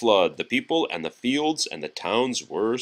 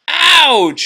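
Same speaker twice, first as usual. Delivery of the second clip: high, loud ouch; cry of pain; 10 dB louder than usual. Acted. Tugboat squeezed between logs, high loud yelp. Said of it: "he cried".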